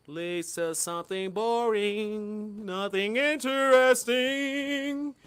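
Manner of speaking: slow, drawn-out mock boredom; bored drawl. Voicing his boredom.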